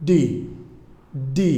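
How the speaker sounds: "ডি" released from the tip of the tongue; each "ডি" isolated and deliberate. Shown as a demonstration. Teacher models the letter D, separated tongue-tip sound. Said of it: "উচ্চারণে জিহ্বার অগ্রভাগ".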